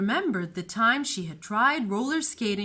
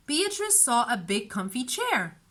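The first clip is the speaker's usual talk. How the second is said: ordinary delivery, nothing marked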